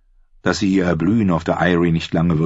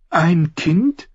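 unsure; 13 semitones higher than usual. A narrator's reading. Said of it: "fragte Sansa unsicher".

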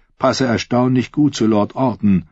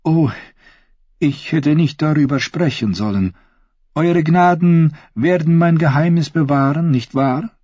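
embarrassed Taena; 8 semitones higher than usual. Acted. Voices Taena blushing, asking secrecy.

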